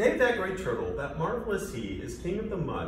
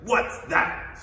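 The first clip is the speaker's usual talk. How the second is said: louder, indignant royal snort; 5 semitones higher, 6 dB louder than usual. Acted. Turtle king spots the rising moon, snorting. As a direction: snort it out in affront. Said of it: "snorted Yertle".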